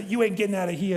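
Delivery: high, loud New York gangster voice; New York accent, hammed-up and loud. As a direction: play the New York tough guy trapping his kids. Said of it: "I always do this New York gangster accent".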